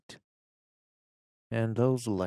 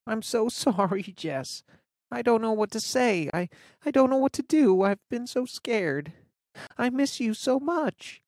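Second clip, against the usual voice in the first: high, teary apology, halting and rushed; tearful girl; 12 semitones higher than usual. A narrator's reading. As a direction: cry it out to her hurt friend. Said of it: "She bursts into tears".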